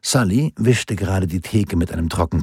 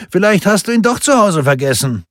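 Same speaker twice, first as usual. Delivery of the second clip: voiced bar buddy; 11 semitones higher, 5 dB louder than usual. A narrator's reading. Lagarde suggests that Lindqvist phone Gwen, high-voiced character.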